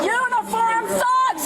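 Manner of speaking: a slow, high jeering yell; high, slow taunting call; slow mocking shout.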